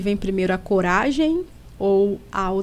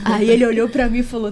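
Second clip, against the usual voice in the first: ordinary talk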